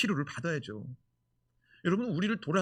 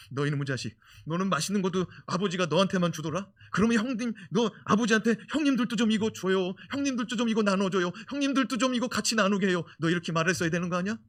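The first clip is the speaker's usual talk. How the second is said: brother scolding; 5 semitones higher, 6 dB louder than usual. Retold or imitated. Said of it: "또 형이 뭐라고 말하겠어요".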